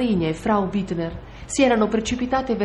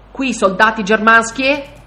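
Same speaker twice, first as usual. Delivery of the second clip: louder, higher, slow impatient demand; 4 semitones higher, 8 dB louder, slower than usual. A narrator's reading. Bearded soldier repeating his unanswered question, impatient. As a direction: repeat the question, losing patience. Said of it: "ripeté il barbuto impaziente".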